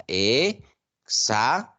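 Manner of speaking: "examen" broken into E, xa, men with pauses; syllable by syllable. Shown as a demonstration. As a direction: say "examen" slowly in three separate syllables: e - xa - men. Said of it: "la separación sería".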